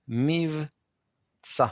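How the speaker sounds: מבצע said slowly, syllable by syllable, mivtsa; slow careful model. Shown as a demonstration. Teacher modelling מבצע slowly for students to repeat. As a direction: stretch out מבצע as mi-v-tsa for the class.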